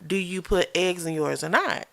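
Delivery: ordinary talk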